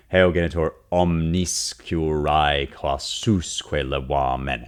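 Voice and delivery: very slow, heavy Latin, every syllable held; slow spondaic recitation. Shown as a demonstration. Teacher scans the Latin line aloud, slow and heavy. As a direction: draw the line out grave and heavy. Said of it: "that's one dactyl".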